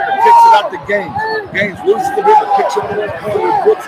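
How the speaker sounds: rising play-by-play energy; excited call. Real, not acted. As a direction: ride the fast break up high. Fast break play-by-play.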